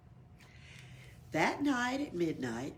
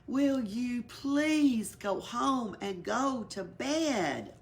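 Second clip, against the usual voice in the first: weary pleading; 5 semitones higher, 4 dB louder, much slower than usual. Acted. Elephant pleads at midnight.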